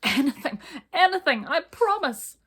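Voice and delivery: high, loud desperate pleading. Acted. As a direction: beg Mister Tickle to stop. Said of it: "pleaded the dragon".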